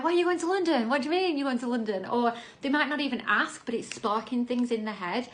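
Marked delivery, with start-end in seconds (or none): 0.0-2.1 nosy follower asking. Retold or imitated. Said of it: "there's people like".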